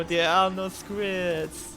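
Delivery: slow, high, strained lament; dramatic mock lament. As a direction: wail it like a tragedy. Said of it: "Oh no".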